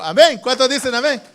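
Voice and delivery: loud, high call to the congregation; rousing call-out. Real, not acted. Preacher rousing congregation for response.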